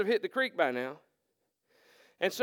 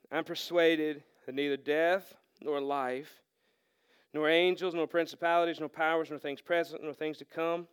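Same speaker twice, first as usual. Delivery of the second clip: lower, softer, measured reading voice; scripture reading; 6 semitones lower, 5 dB quieter than usual. Acted. The pastor reading Romans to the church, quiet reverent reading. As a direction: read it with quiet conviction.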